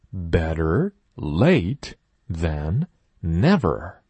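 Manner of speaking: slow, even model sentence; slow teacher model sentence; slow model reading. Shown as a demonstration. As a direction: read it slowly for the class. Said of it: "model sentence for repeating".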